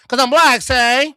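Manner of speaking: high, loud old-timey imitation; old-time voice. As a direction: retell the punchline in a vintage-film voice. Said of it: "Why you talking like that?".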